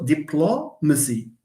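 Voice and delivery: diplomacy said di-PLO-ma-cy; stress on PLO. Shown as a demonstration. Teacher gives the correct word, stress on PLO. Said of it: "It's this plo that we stress".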